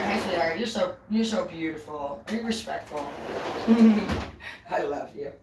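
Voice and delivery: very soft, low endearments; hushed, loving murmur; tender cooing. Real, not acted. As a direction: coo quietly to a little sweetheart. Cooing to the one waving.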